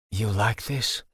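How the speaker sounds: low husky come-on; husky and suggestive, hushed. Acted. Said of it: "I asked in my huskiest voice".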